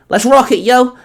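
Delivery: high, loud, cheeky cry; called out. Acted. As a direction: yell it with swagger. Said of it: "Amy called out".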